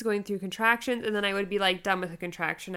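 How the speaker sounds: usual speaking voice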